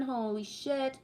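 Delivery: ordinary talk, nothing marked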